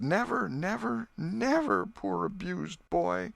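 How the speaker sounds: high, sobbing grieving mother. Acted. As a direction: wail it through sobs. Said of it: "Missus Harper sobbed".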